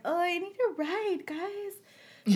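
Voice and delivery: small pitiful voice, acted out; mock plaintive plea. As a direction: plead sweetly up high for a favor. Acts out pleading with friends.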